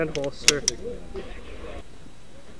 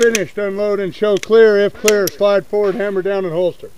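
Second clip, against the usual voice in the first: loud flat safety commands; 2 semitones higher, 6 dB louder than usual. Real, not acted. Range officer calling safety commands.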